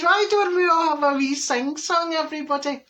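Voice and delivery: high, hammed-up cheery call; rallying the party. Hostess urges the guests to sing, high mock-jolly call. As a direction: call everyone into a singalong, pitched right up.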